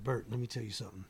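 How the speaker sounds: low, hushed retold aside; confiding near-whisper. Rapper in mogul's ear after meeting, low and hushed. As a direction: lean in and confide, soft and low. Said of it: "I whispered in his ear".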